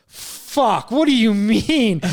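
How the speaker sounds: high-pitched, drawn-out retold dismay; exasperated disbelief. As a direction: stretch it out, incredulous at the bad news. Retold dismay at wrong number.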